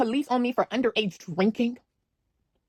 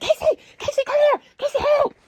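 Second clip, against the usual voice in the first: high desperate calls for Casey; screaming for help. Retold or imitated. Pinned teen screaming for her friend, high desperate calls. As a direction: yell her name from the floor. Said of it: "I'm screaming like".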